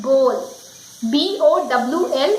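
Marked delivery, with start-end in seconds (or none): 0.0-0.6 "bowl" as bohl, long O; correct bowl. Shown as a demonstration. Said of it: "it is not bowl".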